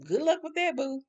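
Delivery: high, airy send-off; mock unbothered. Gloria sees off her departing lover, unbothered. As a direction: wave him off in a high, unworried voice. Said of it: "Gloria wasn't even sweating".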